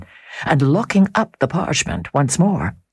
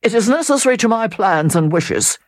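a raised, sulky retort; sulky reply; 8 semitones higher than usual. Acted. Sir Percival answering the Count, sulky raised retort. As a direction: answer grudgingly, resentful. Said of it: "returned the other sulkily".

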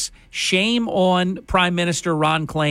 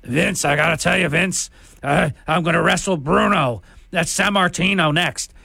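hammed-up gravelly wrestler promo voice; mocking, gravel-throated wrestler impression; gravelly wrestling character; 2 semitones higher than usual. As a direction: growl it out like an old-school wrestling character. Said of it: "this gravelly voiced joke. Sounds like, like a wrestling character".

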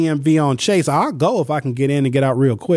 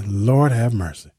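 ordinary talk, nothing marked

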